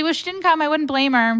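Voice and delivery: way up high, self-mocking; the self-mocking reply to the easygoing boss; high wry quip. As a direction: toss it off as a joke on yourself.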